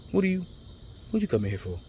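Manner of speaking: softly imitated airport questioning; retold wary question. Airport staff question the arriving traveler.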